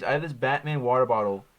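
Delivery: normal talking voice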